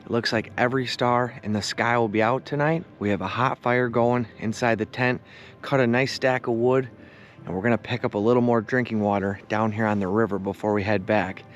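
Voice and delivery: low, calm, relaxed narration; calm evening narration. Acted. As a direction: wind down the day gently.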